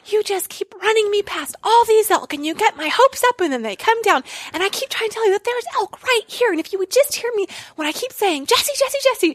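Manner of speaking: high, exasperated half-shouted rant; whisper yelling. Retold or imitated. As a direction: vent at him without scaring the elk. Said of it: "like, whisper yelling".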